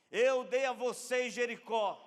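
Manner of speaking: loud, high, slow proclamation; forceful divine declaration. Acted. God granting Jericho to Joshua, proclaimed forcefully. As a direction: proclaim it slowly, weighting each word.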